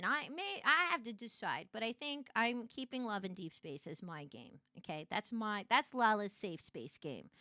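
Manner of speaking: normal talking voice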